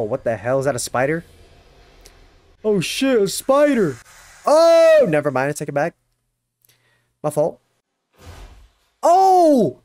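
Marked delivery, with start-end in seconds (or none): 2.6-5.1 startled cry. Real, not acted. Spots a spider on screen. 9.0-9.8 high, loud sudden gasp of surprise; startled shout. Real, not acted. Reacting to a sudden anime moment, startled shout.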